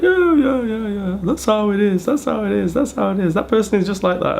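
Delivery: high mock brush-off; dismissive shrug. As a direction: toss it off high and sing-song, repeating. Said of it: "it's just always just like".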